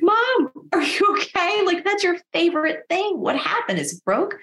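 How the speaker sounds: high-pitched, incredulous retold questions; high, alarmed disbelief; shocked concern. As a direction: imitate a dismayed kid, voice way up. Shocked child questions mom.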